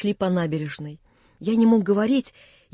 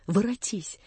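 hushed plea; 8 dB quieter than usual. A narrator's reading. Vanya asking Natasha to return, hushed. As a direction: say it under the voice, falling away. First plea before the urgent repeat.

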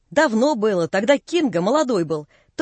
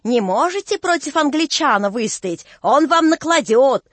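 выше и громче, медленно к концу; дразни с ломаным выговором; ломаный выкрик барина; 5 semitones higher than usual. A narrator's reading. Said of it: "ласковый, кричит". (насмешливый ломаный крик)

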